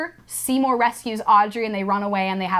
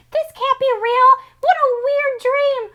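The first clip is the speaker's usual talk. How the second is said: much higher, dreamy disbelief; dazed Audrey; 5 dB louder than usual. Retold or imitated. Audrey staring at the talking plant, high and dazed. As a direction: float it like a dream.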